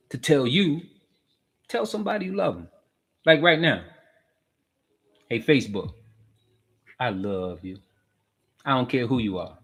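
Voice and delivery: usual speaking voice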